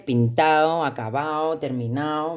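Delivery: "pintao, acabao, terminao" sin d, acabados en "-ao"; pérdida de la d. Shown as a demonstration. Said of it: "La pérdida de la D". ("pintao, acabao, terminao" con final andaluz "-ao")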